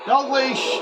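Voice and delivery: high, loud call of a name; real shout at the screen; excited shout. Real, not acted. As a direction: yell the player's name. Yelling at player.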